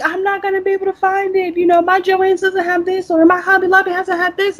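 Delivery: high-pitched panicky shopper imitation; mock freaking out. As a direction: run it on high, like a panicked customer. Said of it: "they're freaking out, like".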